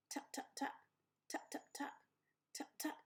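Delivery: soft, whispery tap tap tap; hushed tapping sounds. A narrator's reading. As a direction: tap the words quietly like a beak on shell. Tapping inside egg.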